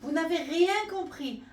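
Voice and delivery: high, loud little monkey cry; monkey shout. Acted. Little monkey yells at the other animals, high and loud. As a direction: yell it out like a cheeky monkey. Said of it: "Un petit singe hurla".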